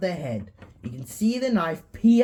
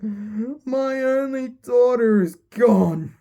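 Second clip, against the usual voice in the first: slow, high, broken grieving voice; mock-sobbing father; crying father; 7 semitones higher, 4 dB louder, slower than usual. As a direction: grieve over the lost daughter. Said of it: "He's crying".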